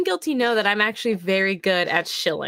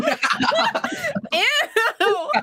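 laughing, higher grossed-out ew; laughing disgust. Real, not acted. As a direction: squeal ew through laughter. Reacting with disgust.

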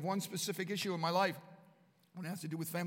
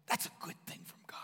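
whisper; 9 dB quieter, faster than usual. Acted. Aside about God's gifts.

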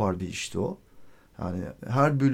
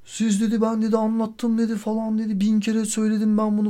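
mock scolding; 9 semitones higher than usual. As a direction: mimic her nagging, higher. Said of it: "başladı azarlamaya". (kadının azarlamasını taklit ederek, tiz ve biraz yüksek sesle)

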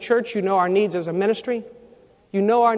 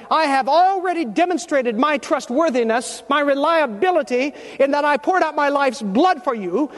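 higher, raised voice of a majestic Jesus who addresses the congregation; 8 semitones higher than usual. Acted. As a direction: speak as a sovereign Lord, with pitch rising. Preacher voices Jesus speaking.

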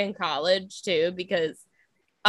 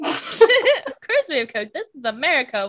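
laughing repeat; 5 semitones higher, 4 dB louder than usual. Real, not acted. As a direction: savor the line while cracking up. Repeats line while laughing.

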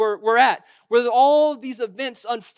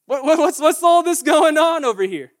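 sarcastic; 7 semitones higher, 9 dB louder than usual. Acted. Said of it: "sarcastically said".

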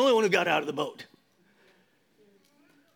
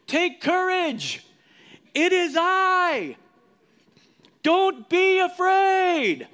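high, loud and slow; Jesus calling to terrified disciples over the waves; voicing Jesus; 11 semitones higher, 5 dB louder, much slower than usual. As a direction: call out reassurance across the storm. Jesus calms frightened disciples.

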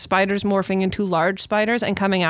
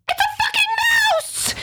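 high shrieking scream of panic; panicked shout. Retold or imitated. Girlfriend shrieking in the dark bed, panicked shout. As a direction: scream it like the mouse is on you. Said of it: "just starts going ballistic".

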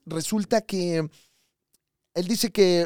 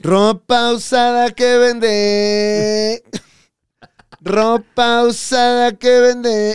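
very slow, louder drawn-out cry; street vendor call; 6 semitones higher, 7 dB louder, much slower than usual. Retold or imitated. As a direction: stretch each word like a hawker. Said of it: "era nada más el nombre del negocio".

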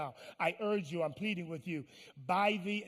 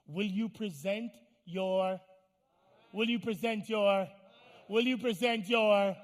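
each repeat louder, drawn out; building insistence; 3 semitones higher, 7 dB louder, slower than usual. Acted. Preacher repeats the verse to his congregation, insistently building. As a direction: press the question harder each time.

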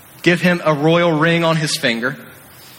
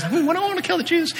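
fast, high, softer plotting; scheming Haman; 12 semitones higher, 5 dB quieter, much faster than usual. Retold or imitated. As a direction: muse over your evil plan.

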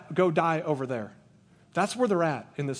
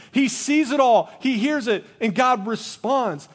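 louder, higher emphatic declaration; preaching build; 7 semitones higher, 6 dB louder than usual. Acted. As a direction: proclaim it with force. Preaching climax.

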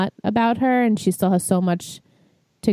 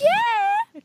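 squealed yeah. Real, not acted. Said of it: "reacting to Hey Bitch".

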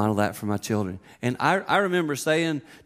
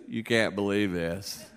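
lower, softer, slow mumbling; sheepish son; 6 semitones lower, 5 dB quieter, slower than usual. Retold or imitated. Son calling again with bad news, sheepish. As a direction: sound guilty and deflated. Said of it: "He goes".

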